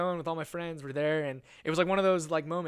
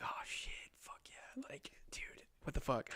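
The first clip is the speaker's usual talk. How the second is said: whispered, drawn-out disbelief; hushed amazement; 11 dB quieter than usual. Retold or imitated. Teen finding a girl's number in his phone, hushed amazement.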